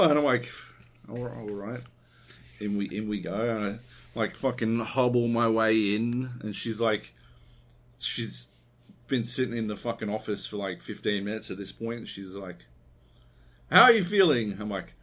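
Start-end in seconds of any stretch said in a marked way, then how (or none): 1.1-1.8 sick and weary. Retold or imitated. Sick man answering the doctor. 13.7-14.6 higher, louder chirpy doctor; doctor's voice. Retold or imitated.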